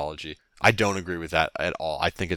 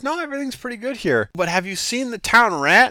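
very high put-on villager voice; hammed-up townsperson, pitched way up; squeaky-high mock villager; townsfolk; 6 dB louder than usual. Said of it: "And they're like".

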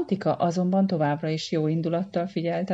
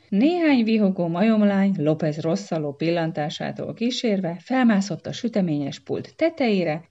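ordinary delivery, nothing marked

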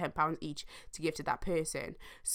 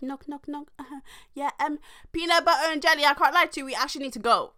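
higher, quick hushed call through a door; whisper through door; 8 semitones higher, faster than usual. Retold or imitated. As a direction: urge friends to leave through the door. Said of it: "what I said through the door".

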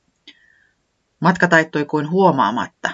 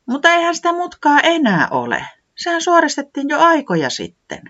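much higher, louder little creature voice correcting a friend; säämies, high; 13 semitones higher, 4 dB louder than usual. Acted. Säämies correcting Reporankka, high and emphatic. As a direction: pipe up high and sure of yourself. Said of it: "säämies sanoi".

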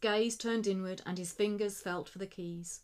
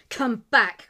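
much louder desperate cry; 11 dB louder than usual. A narrator's reading. Slugger cries after the fleeing thief, much louder. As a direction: shout after the fleeing man. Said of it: "cried the man who was Slugger".

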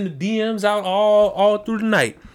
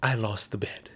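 defeated admission; 8 semitones lower, 9 dB quieter than usual. Pretend. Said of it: "tell you to speak into the microphone and say".

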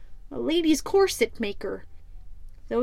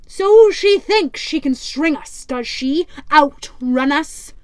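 louder, gruff pirate captain scoffing; 7 dB louder than usual. Acted. Pirate captain mocks the rival ship, loud and scornful.

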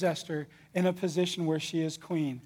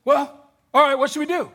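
higher, louder easygoing king; clueless king; 6 semitones higher, 5 dB louder than usual. Acted. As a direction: shrug it off like a careless ruler. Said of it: "the king goes".

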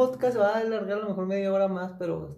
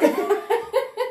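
much higher squealing laugh; high burst of laughter; 14 semitones higher, 4 dB louder than usual. Real, not acted. Friend cracking up at a wrestling story, squealing with laughter. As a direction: crack up at the memory.

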